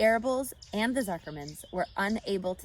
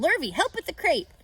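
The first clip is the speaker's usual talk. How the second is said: urgent; 9 semitones higher, 7 dB louder than usual. A narrator's reading. Zuckerman orders Lurvy.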